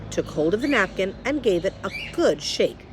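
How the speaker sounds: ordinary delivery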